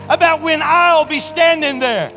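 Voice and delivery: high, loud, fervent preacher's cry; full-force preaching. Real, not acted. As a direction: preach it out with mounting excitement.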